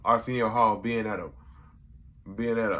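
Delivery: normal talking voice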